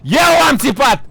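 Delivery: shouting for someone. Real, not acted. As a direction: keep yelling for her, stretching the name. Keeps shouting for the mother.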